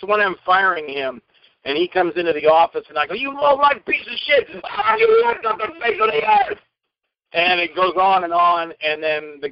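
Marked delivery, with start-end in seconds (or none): 3.1-6.6 much higher, raging insult; furious yell. Acted. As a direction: tear into him as the villain. Acted firing scene, screaming.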